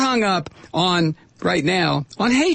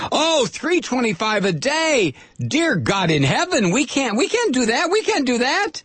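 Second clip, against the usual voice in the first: mock outraged boss; 9 semitones higher than usual. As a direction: ham up a shocked factory owner. Mocking industry owners' outrage.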